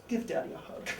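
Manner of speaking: whispered, high and soft; whispered camp line. Retold or imitated. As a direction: breathe it like a creepy old king. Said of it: "Queen Priam".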